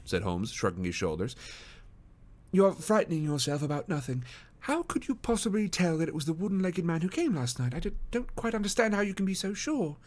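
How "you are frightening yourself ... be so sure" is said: higher-pitched English gentleman, gently doubting; reassuring detective. Acted. Detective calms the worried wife, lightly voiced and courteous. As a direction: soothe and probe as a polite sleuth.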